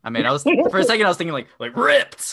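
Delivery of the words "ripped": high-pitched joking outburst; mock hype shout. Blurting the first thought about the title, mock hype shout. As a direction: burst out with it, hammed-up.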